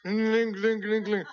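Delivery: loud mock baby babble; baby noises. Kids on the stairs making loud baby noises. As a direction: coo it like a toddler showing off. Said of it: "as we were going".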